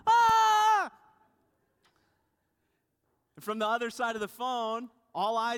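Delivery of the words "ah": high, loud frightened shriek; wife screaming while swinging a broom. Retold or imitated. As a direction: scream as the bat swoops. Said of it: "twenty minutes of screaming".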